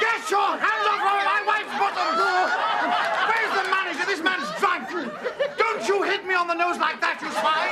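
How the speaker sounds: outraged yelling. Acted. Husband shouting down the guest and the restaurant, outraged yelling. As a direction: rant like a pompous man whose honour is wounded.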